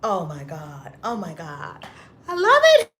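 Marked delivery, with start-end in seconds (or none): 2.3-3.0 high, loud gush into laughter; love it so much you laugh. Real, not acted. Gushing to viewers about Clubhouse, laughing high.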